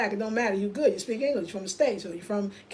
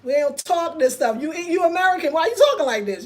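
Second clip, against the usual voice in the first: louder, higher mock outrage at the American; hammed-up indignant scolding; imitating angry salon ladies; 5 semitones higher, 6 dB louder than usual. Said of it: "it's the anger".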